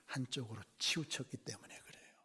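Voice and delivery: hushed sermon whisper; confiding whisper to the congregation. Real, not acted. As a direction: lean in and whisper it. Grave point repeated.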